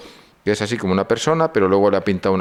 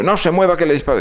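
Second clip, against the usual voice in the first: a scared recruit threatening to shoot, pitched up; nervous sentry threat; 9 semitones higher, faster than usual. Retold or imitated. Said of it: "me apunta un tío temblando y dice".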